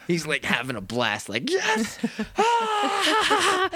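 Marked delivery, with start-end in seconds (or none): 1.5-3.7 sudden high gleeful cry with a laugh; a demon kid whooping with glee; cackling delighted shout; gleeful yell. Retold or imitated. Kid having a blast in hell.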